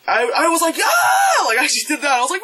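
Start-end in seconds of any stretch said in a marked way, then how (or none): 0.8-1.4 very high excited scream; imitating your own high scream; re-enacted theater scream. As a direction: squeal out loud like a thrilled moviegoer. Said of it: "I have not screamed in a theater".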